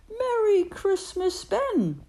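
high, loud little mouse voice, waking; cheerful high greeting from the waking mouse; high bright mouse cry of Merry Christmas; Tim's voice. Acted. Tim wakes, greets Ben.

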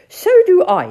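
high, loud squeaky toad voice; eager toad. Acted. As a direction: pipe up keenly as little Toad.

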